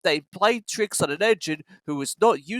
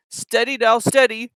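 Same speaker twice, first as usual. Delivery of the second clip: warning; 6 semitones higher, 3 dB louder than usual. A narrator's reading. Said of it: "warned the driver".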